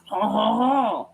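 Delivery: mock-French "haw haw haw"; drawn-out cartoon French guffaw, last note rising. Shown as a demonstration. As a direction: imitate the clichéd French noise. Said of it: "It's a French noise".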